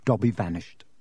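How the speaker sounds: ordinary delivery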